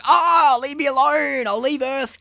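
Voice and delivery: villain giving up in frustration; mock exasperated shout. Voicing exasperated Dormammu.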